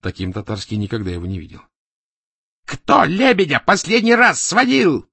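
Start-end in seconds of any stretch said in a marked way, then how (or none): 2.7-5.1 slow, high, loud enraged yell; furious shout. A narrator's reading. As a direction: shout an angry demand from the doorway. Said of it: "закричал Азадовский от дверей". (яростный крик)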